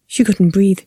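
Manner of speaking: plain reading voice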